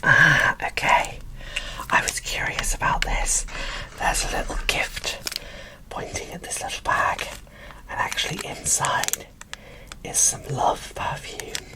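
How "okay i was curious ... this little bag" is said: ordinary talk, nothing marked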